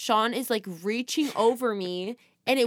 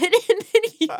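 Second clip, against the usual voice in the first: high, loud laughing talk; 12 semitones higher, 8 dB louder than usual. Real, not acted. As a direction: laugh through the words. Both laughing at the story.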